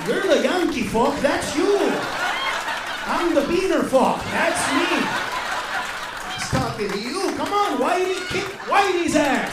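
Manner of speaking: loud, high mock goading; egging a friend on. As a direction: shout it as a cowardly dodge onto your friend.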